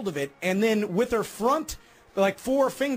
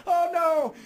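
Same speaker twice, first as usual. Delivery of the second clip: higher, alarmed "Oh no"; dismayed; 8 semitones higher than usual. Retold or imitated. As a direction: cry out at the wounded shark. Wife sees shark with spear.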